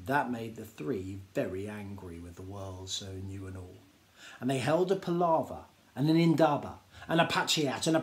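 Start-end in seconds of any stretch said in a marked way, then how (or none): none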